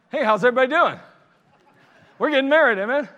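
loud, high, beaming hello to everyone; a carefree groom hails the crowd, loud and high; cheery greeting. Retold or imitated. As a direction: boom it out like a happy groom. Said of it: "I'm like, I'm like".

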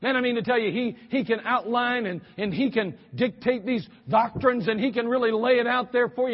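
normal talking voice

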